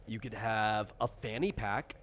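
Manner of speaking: usual speaking voice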